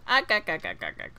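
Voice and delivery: hammed-up cartoon crab grunts; mock cartoon crab sound effect; Mister Krabs grunts. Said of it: "I'm Mister Krabs".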